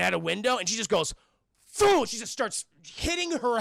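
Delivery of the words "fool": sudden high, loud burst; imitated smash noise. Woman hits her head through glass, explosive loud smash noise. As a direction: punch out an impact sound.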